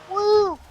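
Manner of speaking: loud, very high-pitched woo; excited whoop. Real, not acted. Gamer cheering mid-race, high excited whoop.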